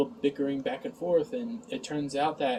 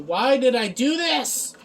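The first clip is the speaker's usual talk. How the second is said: loud, high, slow cry of regret; exasperated wail; 10 semitones higher, 7 dB louder than usual. Real, not acted. Reviewer regretting watching an awful show, exasperated wail. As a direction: wail it out, stretching each word.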